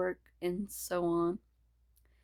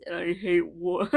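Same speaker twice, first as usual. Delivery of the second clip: put-on Scottish accent; 8 dB louder than usual. Character declares hatred of war in a Scottish accent. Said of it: "Why did I turn Scottish?".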